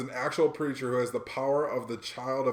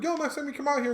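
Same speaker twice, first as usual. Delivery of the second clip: mock friend voice; 4 dB louder than usual. New friend inviting him back to Stockholm, high mock voice.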